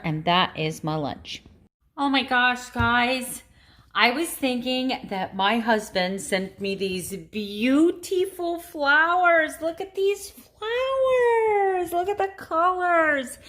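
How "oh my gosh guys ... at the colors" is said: high, gushing over surprise flowers; delighted gush. Real, not acted. Wife gushing on camera over surprise flowers, delighted. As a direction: squeal with real delight at a gift.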